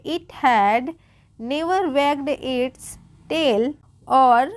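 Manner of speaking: very slow, separated words; slow read-aloud. Shown as a demonstration. Teacher repeating the line for learners, slow word-by-word reading. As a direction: read it out one word at a time. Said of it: "okay, repeat करेंगे".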